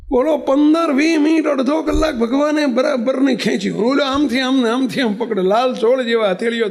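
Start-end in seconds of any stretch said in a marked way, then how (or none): none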